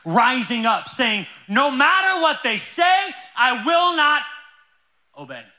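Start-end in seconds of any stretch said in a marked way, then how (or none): 1.5-5.4 loud, high, drawn-out defiance; rallying cry, raised high; defiant declaration. Acted. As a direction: belt it out slowly like a vow. Acting a church's defiant declaration.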